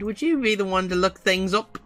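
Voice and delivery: ordinary delivery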